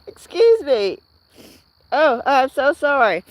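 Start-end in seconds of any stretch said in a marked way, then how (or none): 0.1-1.0 gasping apology after sneezing; after a sneeze. Real, not acted. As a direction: catch your breath after a sneeze. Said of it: "So sorry about the sneeze".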